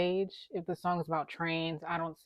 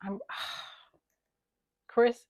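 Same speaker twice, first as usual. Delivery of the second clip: ordinary talk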